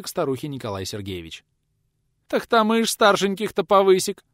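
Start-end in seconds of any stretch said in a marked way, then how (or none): 2.3-4.2 put-on high, loud tearful old woman; tearful wail. A narrator's reading. Old woman answers the visitor, tearful high wail. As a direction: lament in a raised, tearful voice. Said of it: "Зашлась слезами та".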